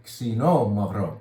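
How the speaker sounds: Xinomavro slowly, with a ks start and stress on MAV; teaching the ks start of Xinomavro, slowly; careful pronunciation. Shown as a demonstration. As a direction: spell out Xinomavro slowly for the viewers. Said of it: "kinda like a K S at the same time".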